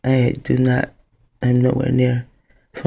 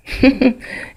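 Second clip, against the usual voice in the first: short high laugh; 10 semitones higher than usual. Real, not acted. Laughs at own remark.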